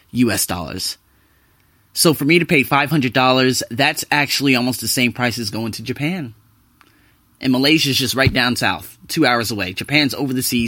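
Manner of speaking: normal talking voice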